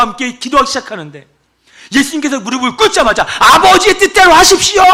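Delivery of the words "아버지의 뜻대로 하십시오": high, loud, showy cry to the Father; mock grand proclamation. Preacher mocking a showy prayer, loud and high. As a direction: belt it out like a pompous prayer. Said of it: "막 이렇게 했습니까?".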